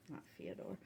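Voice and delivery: "not Fyodor" whispered very softly; whispered correction. Real, not acted. Reader catching her own wrong name, whispering. Self-correction under breath.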